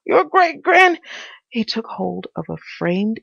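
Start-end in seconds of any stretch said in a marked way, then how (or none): 0.0-1.0 high, loud strained voice; panting Pycraft. A narrator's reading. Pycraft struggling down from the ceiling, panting. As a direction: force it out high, mid-struggle. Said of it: "he panted as he did so".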